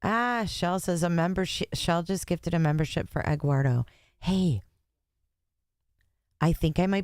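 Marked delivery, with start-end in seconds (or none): none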